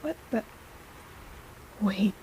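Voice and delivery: quiet, startled catch; hushed surprise. Acted. Healer startled by something on your hand, hushed. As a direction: stop short, puzzled.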